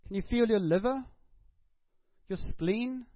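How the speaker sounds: high, loud, insistent preaching questions; fervent preacher hammering rhetorical questions; preaching at full force. Real, not acted. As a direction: press the congregation with each question.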